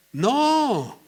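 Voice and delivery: high, drawn-out "no" of feigned innocence; acted wounded protest, long and high; protesting denial. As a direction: stretch out a high, hammed-up denial. Acting Saúl's excuse to Samuel.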